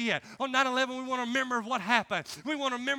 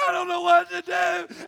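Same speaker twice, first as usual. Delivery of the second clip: high wail of a weeping recruit; hammed-up tearful cry; mock crying; 6 semitones higher, 5 dB louder than usual. Weeping recruit overwhelmed by his instructor, mock wailing. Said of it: "was crying and weeping".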